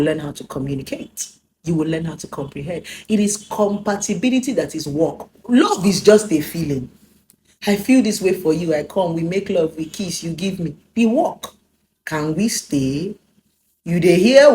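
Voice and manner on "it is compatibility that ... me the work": normal talking voice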